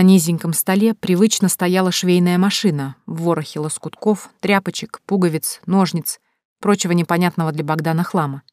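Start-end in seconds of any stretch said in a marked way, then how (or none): none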